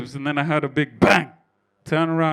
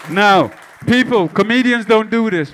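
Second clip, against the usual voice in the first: loud crowd call; 6 semitones higher, 7 dB louder than usual. Real, not acted. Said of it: "addresses the crowd".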